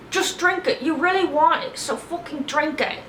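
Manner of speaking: high, pushy mock snap; impatient urging. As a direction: egg her on, high and impatient. [name] urging Natalie.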